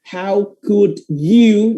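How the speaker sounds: "How could you" very slow and high, each word pressed; drawn-out, insistent "HOW COULD YOU"; heavy emphasis. Shown as a demonstration. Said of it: "kalau misalnya mau bener-bener ditekenin".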